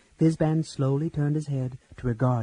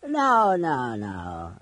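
put-on sleepy old man; much slower than usual. Old Fizban half-asleep stopping the execution, sleepy. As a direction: wake up mid-word, dozy. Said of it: "said a sleepy voice".

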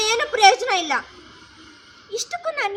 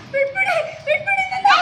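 crying plea; 9 semitones higher than usual. Acted. Cornered woman cries and shouts at her accusers to let her go. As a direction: cry out to be let go, very high.

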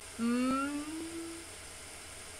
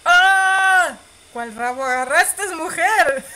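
much louder, higher outraged yell; shocked laughing shout; 5 semitones higher, 13 dB louder, much slower than usual. Real, not acted. Player scandalized by the character grabbing a tail, loud shout.

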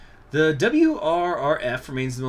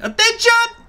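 very high, loud shouted command; barking order; 11 dB louder than usual. Acted. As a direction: bark it at the troops.

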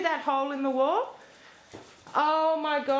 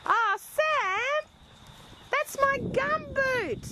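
shocked scolding; 7 semitones higher than usual. Real, not acted. Finds dog chewed her gumboot.

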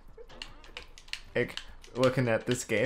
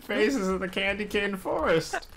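higher, talking through laughter; giggling while speaking; laughing; 9 semitones higher than usual. Real, not acted. Laughing at teammate's glitch.

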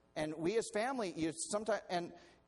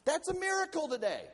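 1 emphatic exclamation; 11 semitones higher, 6 dB louder than usual. Real, not acted. Preacher exclaiming.

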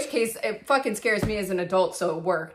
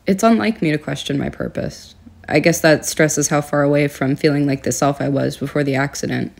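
dramatic confessional monologue; 5 semitones lower, 6 dB louder than usual. Acted. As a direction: deliver it like a moody film narration. Mock-diary monologue continues.

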